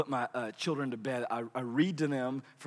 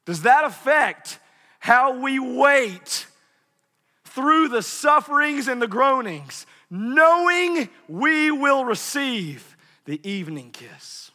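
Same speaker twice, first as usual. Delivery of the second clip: loud slow preaching; 9 semitones higher, 8 dB louder, slower than usual. Acted.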